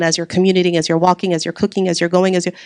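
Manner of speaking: ordinary delivery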